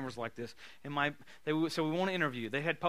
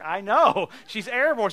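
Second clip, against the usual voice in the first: excited reply; 5 semitones higher, 13 dB louder, slower than usual. Retold or imitated.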